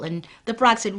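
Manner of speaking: usual speaking voice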